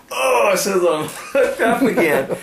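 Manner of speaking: high, laughing exclaim; high gleeful laugh-talk; laughing excitement. Retold or imitated. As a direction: laugh through the lucky catch. Hooking fish again, laughing.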